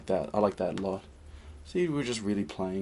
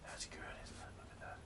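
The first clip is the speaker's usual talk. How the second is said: hushed admiration; whisper; 17 dB quieter than usual. Real, not acted. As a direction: breathe it out, voiceless. Admiring his stroke, hushed.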